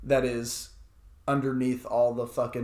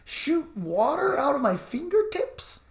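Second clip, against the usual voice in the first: mock hero; 12 semitones higher than usual. Host playing a hero being recruited, giddy and high. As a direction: play the flattered recruit, breathless.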